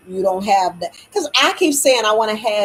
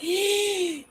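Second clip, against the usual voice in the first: sharp high gasp of mock shock; 10 semitones higher, 4 dB quieter than usual. Hearing what she was taught was a lie, a sharp mock gasp. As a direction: gasp as if scandalised. Reaction to bald-faced lies.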